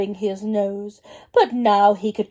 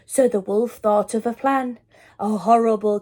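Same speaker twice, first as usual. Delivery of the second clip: ordinary delivery, nothing marked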